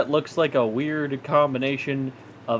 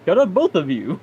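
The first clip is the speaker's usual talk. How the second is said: laughing; 7 semitones higher, 4 dB louder than usual. Acted. Scolding through laughter.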